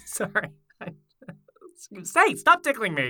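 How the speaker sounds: laughing, high, being tickled. Pretend. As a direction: squeal while tickled. Tickle fight.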